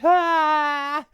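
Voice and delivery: put-on high squealing baby coo; very high loud baby noise; baby squeal imitation. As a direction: imitate the baby's delighted shriek. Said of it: "it's like".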